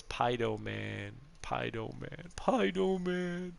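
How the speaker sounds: put-on "Pido Man" said like a toddler, dropping the "S" and the "r" of Spider-Man; slow, pleading kid voice: "Pido Man"; toddler mispronunciation. Dad imitating his son saying "Pido Man" for Spider-Man. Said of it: "he can't say Spider-Man".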